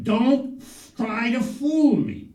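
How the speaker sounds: hammed-up parental exasperation; scolding father. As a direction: drag out the accusation. Father scolds son by phone.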